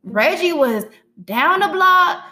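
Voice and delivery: high, loud gossipy tattler; gossiping friend. Retold or imitated. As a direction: tell it like juicy gossip. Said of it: "somebody came to me today".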